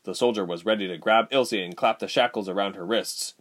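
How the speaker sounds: ordinary delivery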